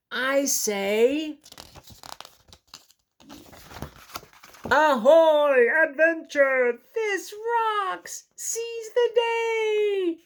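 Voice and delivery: very high, loud joyful cry; thrilled Jelly. Acted. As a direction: burst with glee at joining in. Jelly joins the adventure.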